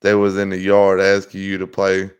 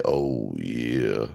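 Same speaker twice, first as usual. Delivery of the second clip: very low, soft drawn-out oh yeah; deep sultry oh yeah; sleazy oh yeah; 11 semitones lower, 8 dB quieter than usual. Acted. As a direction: purr it low and suggestive. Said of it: "Why did I have to say it like that?".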